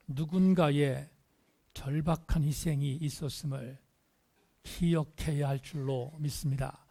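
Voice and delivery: ordinary talk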